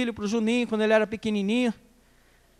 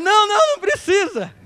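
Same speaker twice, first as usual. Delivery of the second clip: nervous refusal; 11 semitones higher, 5 dB louder than usual. Retold or imitated. Refusing ride, hiding the lie.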